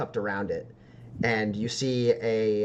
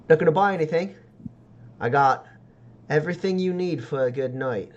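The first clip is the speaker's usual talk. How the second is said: higher-voiced backstreet tonic seller; street peddler's pitch; 5 semitones higher, 4 dB louder, faster than usual. Seller offering tonics to a browsing customer, peddler's pitch. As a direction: talk up the goods like a street hawker.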